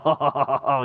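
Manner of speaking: low, pleased "oh" with a chuckle; flattered chuckle. Retold or imitated. Farmer enjoying his wife's praise, mock flattered chuckle. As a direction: imitate a husband basking in praise, low.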